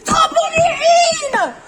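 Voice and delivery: very slow, high, loud wailing scream; tantrum scream. Acted. Nathan screaming a tantrum at his mother, very loud and slow. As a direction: howl it like a tantrum.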